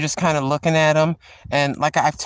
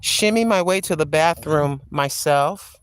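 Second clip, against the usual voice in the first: normal talking voice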